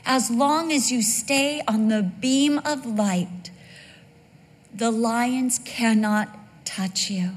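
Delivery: ordinary talk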